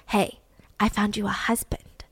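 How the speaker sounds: whispered, a conspirator's hush; secret whisper. Retold or imitated. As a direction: breathe it like a secret being shared. Leonardo secretly tells Festima news.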